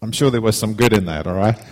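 ordinary talk

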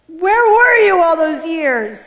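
retold wail of reproach; anguished demand. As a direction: stretch it out loudly, demanding an answer. Acted confronting of God.

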